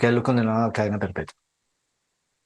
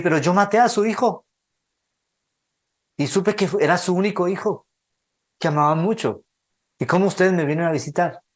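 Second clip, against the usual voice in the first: higher, bewildered pleading as the killer; the killer can't believe the parents came, higher and amazed; stunned disbelief; 6 semitones higher than usual. Retold or imitated. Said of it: "el tipo se queda sorprendido".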